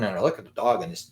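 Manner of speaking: ordinary delivery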